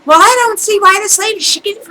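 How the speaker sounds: fast, high-pitched mock protest; imitated angry customer. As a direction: mimic an indignant customer arguing back. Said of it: "she was so angry".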